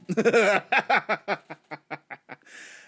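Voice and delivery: a loud burst of laughter. Real, not acted. Laughing loudly after startling the listeners. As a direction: laugh out loud at your own gag.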